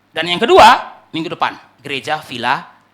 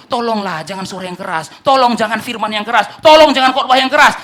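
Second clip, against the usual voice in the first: lightly acted plea, building to a shout; pleading church; 5 semitones higher, 10 dB louder than usual. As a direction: plead as the sleeping church, louder each time. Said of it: "apa yang diminta oleh gereja yang tidur".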